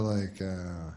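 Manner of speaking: usual speaking voice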